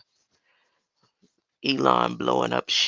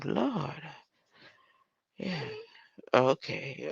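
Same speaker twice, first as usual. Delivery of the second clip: ordinary talk, nothing marked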